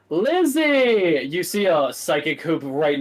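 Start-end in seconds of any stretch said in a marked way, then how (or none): 0.1-1.3 excited call. Acted. Greeting an old acquaintance at door.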